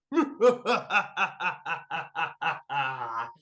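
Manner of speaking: high cartoon cackle; evil cackle. Super Noodles gloating after transforming, high cackle. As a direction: cackle like a newly made supervillain.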